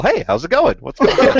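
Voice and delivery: upbeat carefree greeting, laughing; bright and unbothered, with a laugh; cheery, laughing. Retold or imitated. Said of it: "never loses that positive outlook".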